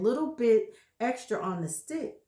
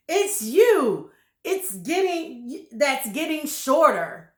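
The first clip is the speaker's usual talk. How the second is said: louder, higher gloating husband; gloating grin; 5 semitones higher, 6 dB louder than usual. Acted. Husband taunting his wife, gloating loudly. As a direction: gloat over her with a horrible grin. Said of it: "grinning horribly".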